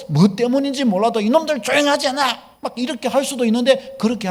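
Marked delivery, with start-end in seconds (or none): 1.2-2.5 high-pitched acted telling-off; mock scolding. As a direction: scold them like an annoyed guard. Guards scolding the singing prisoners.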